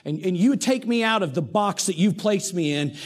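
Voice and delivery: ordinary talk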